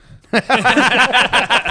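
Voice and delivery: big burst of laughter; a loud laugh. Real, not acted. Laughs telling the seat story.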